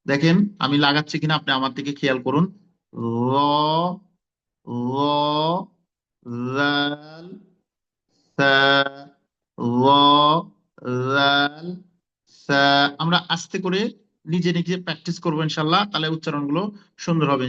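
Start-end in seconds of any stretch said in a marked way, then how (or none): 2.9-12.6 র, জাল, সা said softly with tongue on teeth tips; soft letters. Shown as a demonstration. Teacher showing soft letters from the teeth tips. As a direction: let জাল and সা slip out gently. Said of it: "একেবারে soft, নরম করে".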